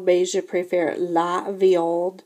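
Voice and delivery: ordinary talk, nothing marked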